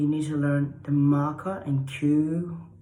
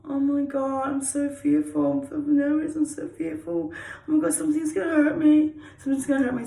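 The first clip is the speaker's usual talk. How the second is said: high, frightened squeal; nervous; 10 semitones higher than usual. Shown as a demonstration. Showing the class nervous energy, high and scared. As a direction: fret in a tiny scared voice. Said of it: "nervous energy means".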